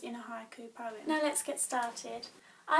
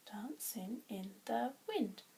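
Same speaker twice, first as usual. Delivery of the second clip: "dancing in the wind" softer, in separate syllables; counting syllables; 3 semitones lower, 5 dB quieter than usual. Shown as a demonstration. Teacher double-checking the five syllables, soft and separated. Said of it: "Five syllables".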